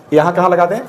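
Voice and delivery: usual speaking voice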